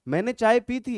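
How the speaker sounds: ordinary talk, nothing marked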